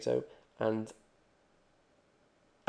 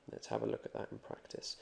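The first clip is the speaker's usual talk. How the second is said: whispered aside; 9 dB quieter than usual. Real, not acted. Aside before example.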